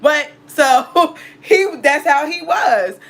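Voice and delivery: loud laughter through the words; laughing. Real, not acted. Laughing about how loyal he was, laughing. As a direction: laugh it out, slowly. Laughing after her rant.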